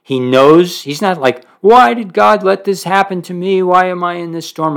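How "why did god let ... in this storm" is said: mock whining complaint. Mock complaining man quoted.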